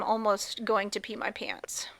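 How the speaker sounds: hushed mock-offended whisper. Real, not acted. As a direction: whisper back, pretending offense. Mock indignant reply.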